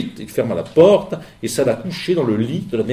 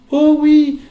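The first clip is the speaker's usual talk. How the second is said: put-on high bright girl's cry, much higher and louder; fillette enjouée; 7 dB louder than usual. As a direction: exclaim it like an eager little girl. La fillette s'exclame. (petite fille enthousiaste, voix aiguë)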